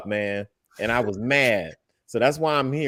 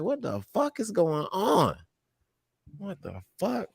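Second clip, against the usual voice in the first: confused disbelief; 7 semitones higher, 5 dB quieter, slower than usual. Retold or imitated. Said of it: "I was confused".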